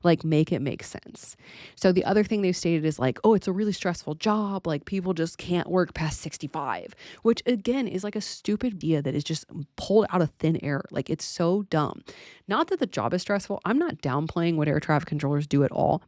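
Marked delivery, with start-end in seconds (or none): none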